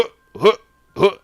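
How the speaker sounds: short, high, loud huffs; combat effort grunts. Acted. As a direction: separate grunts for each swing. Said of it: "Everybody stands there and goes".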